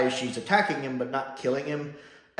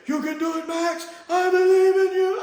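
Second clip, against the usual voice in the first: very high, strained fake yelling voice; [name]'s falsetto; 7 dB louder than usual. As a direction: cheer him on in a mock falsetto shout. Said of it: "he goes into that fake voice".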